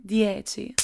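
normal talking voice

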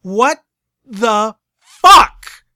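loud, high incredulous rant; spitting angry. Acted. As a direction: build to an outraged shout. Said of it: "sounded like she had spit her bit".

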